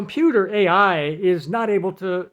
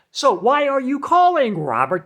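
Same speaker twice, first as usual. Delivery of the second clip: mock computer voice; 6 semitones higher, 4 dB louder than usual. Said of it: "The computer says".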